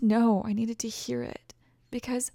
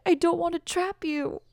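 ordinary delivery

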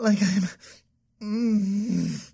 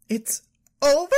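normal talking voice

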